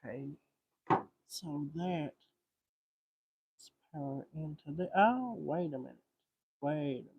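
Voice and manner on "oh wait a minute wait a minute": ordinary talk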